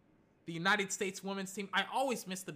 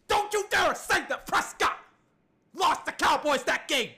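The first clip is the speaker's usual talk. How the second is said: high, loud angry warning; indignant shout; 7 semitones higher, 7 dB louder than usual. Real, not acted. Host defends Prescott against fans blaming him, indignant shouting. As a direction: shout them down fiercely.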